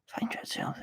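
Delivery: breathy whispered aside. Real, not acted. Gamer whispering to self, whisper. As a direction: say it under your breath.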